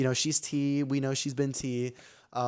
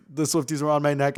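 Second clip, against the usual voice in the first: usual speaking voice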